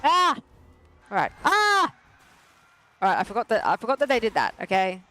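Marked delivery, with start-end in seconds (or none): 0.0-0.4 sharp high yelp of alarm; sudden scared cry; startled yelp. Real, not acted. Startled by boss attack. 1.4-1.9 sharp high yelp of alarm; sudden scared cry; startled yelp. Real, not acted. Startled by boss attack.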